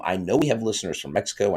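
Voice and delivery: ordinary talk, nothing marked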